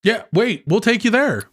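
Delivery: high, friendly put-on chatter; eager homie voice. Co-host playing homies offering a lift to Belinda's, eager. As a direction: pitch it up, all chummy.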